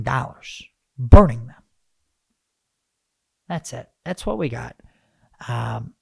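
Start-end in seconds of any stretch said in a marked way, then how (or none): 1.0-1.6 loud emphasis. Real, not acted. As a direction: burst out loud. Emphatic punchline.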